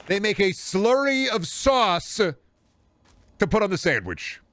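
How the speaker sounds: drawn out in mock horror; outraged disbelief. Real, not acted. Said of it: "appalled rant".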